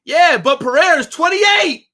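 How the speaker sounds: loud frustrated yelling. Real, not acted. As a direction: yell it out at chat. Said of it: "And I'm not yelling at you".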